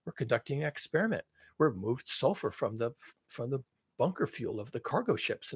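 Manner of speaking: soft, understated mock-polite quote; polite imitation. Imitating Paul reporting on cargo ship fuel, polite understatement. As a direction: state it mildly and politely, as he would. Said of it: "he's very polite about it".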